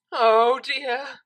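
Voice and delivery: sudden panic dawning; alarmed oh dear. A narrator's reading. Octavia realizing the DJ has her equipment, alarmed.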